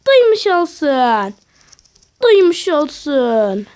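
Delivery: slow, drawn-out announcement; proclaiming. Real, not acted. Gamer to all viewers, slow proclamation. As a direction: stretch each word out for all to hear.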